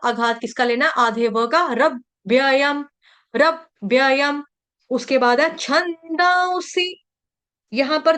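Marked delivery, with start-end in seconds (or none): 1.7-4.4 slow रव्ययम with a hit on र and doubled व; stress on र. Shown as a demonstration. Teacher drilling the stress in रव्ययम. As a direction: punch the र of रव्ययम. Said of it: "र के ऊपर आघात".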